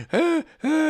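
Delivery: high pained yelps, imitated; mock crying yelp. Friend with burning mouth, mock crying yelps. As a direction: yelp like a kid whose mouth is on fire. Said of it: "him just crying".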